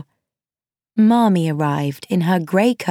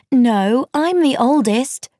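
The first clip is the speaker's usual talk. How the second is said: higher-pitched insistent protest; crying out; 5 semitones higher than usual. A narrator's reading. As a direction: cry out to overrule the others. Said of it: "cried Meg".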